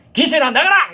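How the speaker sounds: high, loud order; shouted command. Retold or imitated. Preacher retelling how he ordered the spirit out, shouting. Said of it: "이랬어요, 제가".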